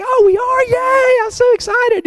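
put-on high-pitched, gleeful squeal of a young daughter; excited little girl. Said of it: "She was like".

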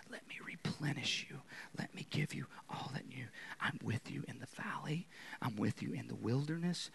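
soft, intimate whisper into the ear; God whispering. Acted. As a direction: whisper reassurance with gentle pauses. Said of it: "he's whispering things in our ears".

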